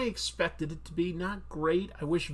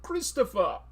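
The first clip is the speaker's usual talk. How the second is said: raised pitch, odd halting delivery; Christopher Walken; 4 semitones higher, slower than usual. Acted. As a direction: say it as a halting celebrity impression.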